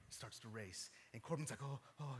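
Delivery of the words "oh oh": soft, low breathy gasps in imitation; imitated heavy breathing. Dad imitating his son at first sight of deer, soft panting. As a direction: mimic a nervous young hunter panting quietly. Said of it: "he's breathing heavy".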